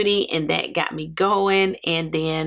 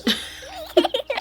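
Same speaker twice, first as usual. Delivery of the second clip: high laugh. Real, not acted. Laughs at her cheap readers.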